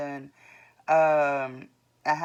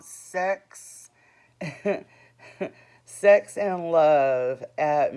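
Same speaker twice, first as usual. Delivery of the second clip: laughing; 9 dB louder, much slower than usual. Real, not acted. Laughing at own blog title.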